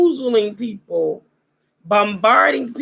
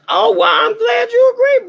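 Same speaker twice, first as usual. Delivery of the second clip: higher and louder, delighted; excited shout; 11 semitones higher, 5 dB louder than usual. Real, not acted. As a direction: burst out gleefully. Shouted reply to the audience.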